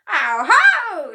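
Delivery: high, very loud gloating cry. A narrator's reading. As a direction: pounce on the discovery. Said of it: "she cried".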